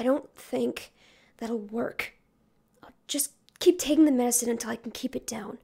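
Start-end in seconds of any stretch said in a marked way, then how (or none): 2.8-5.6 weak whisper. Acted. Sick turtle weak reply.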